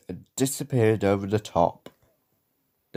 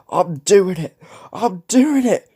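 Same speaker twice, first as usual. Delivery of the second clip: high, loud effortful cry; panting; 10 semitones higher, 6 dB louder than usual. A narrator's reading. James halfway up the hill, panting excitedly. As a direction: exert yourself, rising in pitch. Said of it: "he panted".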